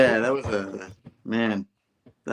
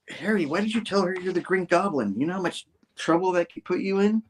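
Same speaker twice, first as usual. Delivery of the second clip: high-pitched retold pleading reproach; mock dismay in a high voice; dismayed scolding; 9 semitones higher than usual. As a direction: act out a worried friend's telling-off, pitched up. Peter scolding Harry, acted out.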